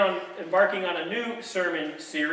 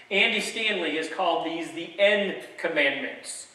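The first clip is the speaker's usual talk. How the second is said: ordinary delivery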